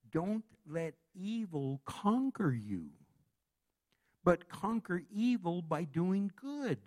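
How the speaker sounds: scripture reading. Shown as a demonstration. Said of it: "Romans chapter twelve, verse twenty-one says".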